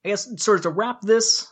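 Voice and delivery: ordinary delivery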